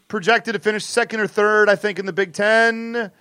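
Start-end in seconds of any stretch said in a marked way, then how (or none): none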